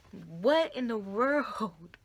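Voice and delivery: drawn-out, bewildered question; slow stunned disbelief. Retold or imitated. Looking at bloody hands, slow stunned disbelief. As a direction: let each word hang in shock.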